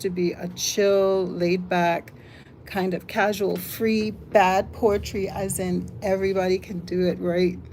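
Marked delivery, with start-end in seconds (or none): none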